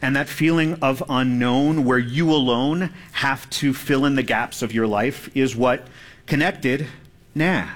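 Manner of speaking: ordinary delivery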